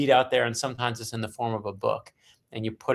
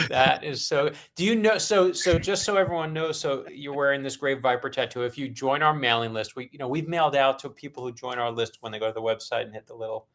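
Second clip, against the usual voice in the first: ordinary delivery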